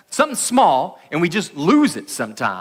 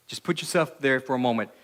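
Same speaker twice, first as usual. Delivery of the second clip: ordinary delivery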